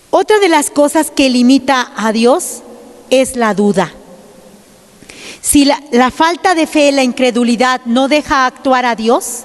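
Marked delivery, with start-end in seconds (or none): none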